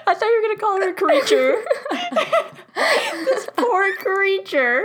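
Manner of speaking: high, breaking into laughter; laughing hard. Real, not acted. Friend cracks up at the word.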